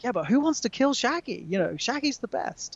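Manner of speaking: tag. Retold or imitated. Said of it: "And he's like".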